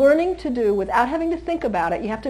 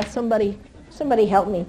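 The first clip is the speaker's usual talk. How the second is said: normal talking voice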